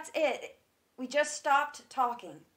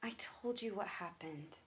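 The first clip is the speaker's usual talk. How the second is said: unvoiced, lower, barely audible; pained whisper; 6 semitones lower, 13 dB quieter than usual. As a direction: shrink away and whisper it. Pressed about last conversation.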